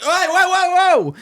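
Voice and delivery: high-pitched, acted-out panic; retold alarmed yelp. Podcaster retelling his phone dialling a strip club, alarmed yelp. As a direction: yelp it as if stopping a runaway call.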